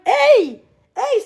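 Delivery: normal talking voice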